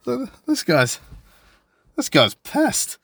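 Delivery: high-pitched, incredulous retelling; amused realization. Retold or imitated. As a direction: squeak out the realization, slow on the last words. Retold dawning realization colleague is drunk.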